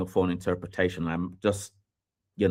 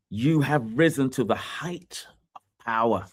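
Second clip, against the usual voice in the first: ordinary delivery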